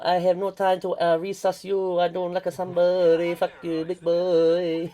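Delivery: high, loud mock Chinese accent; hammy prank-call Chinese accent. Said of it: "the Chinese accent".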